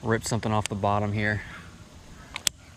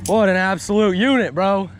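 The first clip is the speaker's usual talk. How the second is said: frat bro; 7 semitones higher, 5 dB louder than usual. Real, not acted. Celebrating a big fish.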